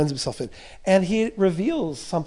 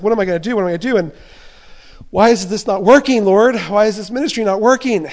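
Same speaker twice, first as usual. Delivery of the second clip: louder, pleading questions; desperate plea; 3 semitones higher, 7 dB louder, faster than usual. Acted. Paul crying out to the Lord when ministry fails, loud desperate plea. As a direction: cry out to God, bewildered. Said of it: "He's like".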